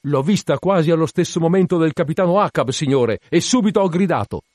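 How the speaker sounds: high, loud, eager report; calling back. A narrator's reading. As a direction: shout your answer up to the captain. Lookout calls back to captain.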